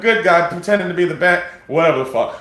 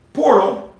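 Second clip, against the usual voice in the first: ordinary delivery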